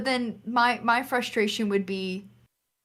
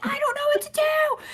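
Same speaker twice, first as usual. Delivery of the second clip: very high mock-panic wail; retold panicked screaming. Player to mom during boss fight, high panicked squeal. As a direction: squeal it helplessly, acting out panic. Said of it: "we're, like, freaking out, screaming".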